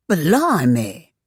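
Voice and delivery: put-on exclaimed in a Cockney accent. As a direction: blurt it out like a London cabbie. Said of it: "Ruark mimed in a Cockney accent".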